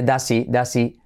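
"does he" linked as "das-ee"; dropped H. Shown as a demonstration. Teacher showing the skipped H, "does he" as "das-ee". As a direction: skip the H in "he" after "does". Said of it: "saltamos la H".

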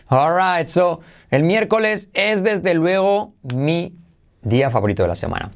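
ordinary talk

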